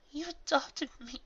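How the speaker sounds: high, slow, cracking plea. A narrator's reading. Astor pleads with Celestia, voice cracking. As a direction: let the voice break on it. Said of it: "her voice cracking".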